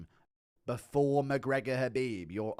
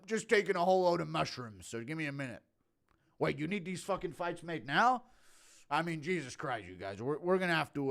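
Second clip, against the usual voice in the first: lightly impersonated fight promoter, flustered; 4 semitones higher, 3 dB louder than usual. Acted. Promoter booking fights on mushrooms, lightly impersonated. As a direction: play a rattled boss improvising with stumbles.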